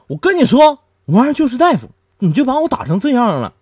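high, loud smug brag; cocky boast. Retold or imitated. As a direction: gloat about the doctor uncle. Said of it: "子超就说了".

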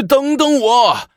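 high, drawn-out shout from afar; calling out loudly. A narrator's reading. A man running up behind the ceremony, shouting. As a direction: holler for them to wait. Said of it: "有人高喊".

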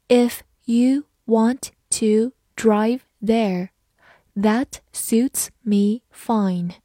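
very slow, word by word; spaced-out, careful model reading with gaps between words. Shown as a demonstration. Teacher reads the example once for learners, slowly. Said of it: "好，慢读一遍".

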